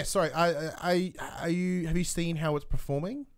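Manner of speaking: normal talking voice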